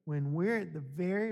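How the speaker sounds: usual speaking voice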